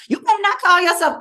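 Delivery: high, loud, fast mock-scolding in a Baltimore accent; Baltimore accent, missed. Performer mimicking Baltimore people who would object, Baltimore accent. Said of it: "that's the wrong accent".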